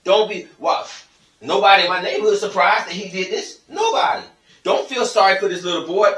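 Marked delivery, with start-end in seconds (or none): 3.7-6.1 squeaked up very high in disbelief; emphatic exclamation. Real, not acted. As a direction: hammer home that nobody at all. Ranting that nobody is surprised.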